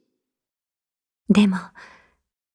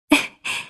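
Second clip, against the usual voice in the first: short breathy chuckle; small, airy laugh; soft chuckle; 6 dB quieter than usual. Acted. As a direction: let out a quiet little laugh. Light chuckle while coaching calligraphy.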